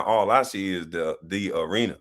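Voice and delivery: ordinary talk, nothing marked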